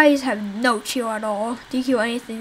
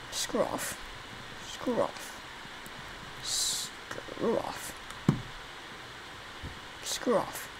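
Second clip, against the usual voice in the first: hoarse whispered taunt, much softer; creepy whisper; 2 semitones lower, 14 dB quieter, much slower than usual. Acted. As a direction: whisper it like a ghost warning them away. Creepy repeated taunt at zombies.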